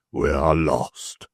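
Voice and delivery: a worried man swearing under his breath; Stracey's voice. Acted. Stracey in despair.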